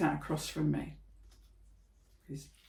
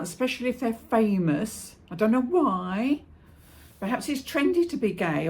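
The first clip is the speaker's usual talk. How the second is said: loud, higher-pitched opinionated rant; mother on her soapbox, voice raised; 5 semitones higher, 6 dB louder than usual. Acted. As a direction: hold forth loudly, sure of every theory. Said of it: "I was having one of me rants".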